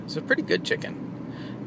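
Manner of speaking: hushed, breathy bird-call imitation; turkey impression. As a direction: whisper it like a sneaky turkey. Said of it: "That's my turkey impression".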